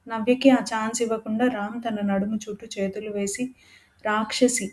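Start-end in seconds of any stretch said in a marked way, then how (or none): none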